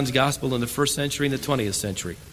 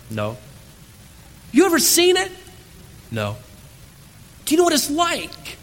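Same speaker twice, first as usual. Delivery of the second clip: a much higher, challenging questioner; mocking skeptic; 11 semitones higher than usual. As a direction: needle the preacher with each question.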